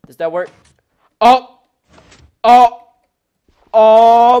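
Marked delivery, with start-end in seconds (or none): 1.2-4.4 higher and much louder delighted shouts; excited gasps. Real, not acted. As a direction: burst out with delight. Toilet build works.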